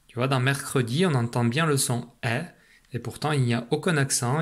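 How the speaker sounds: normal talking voice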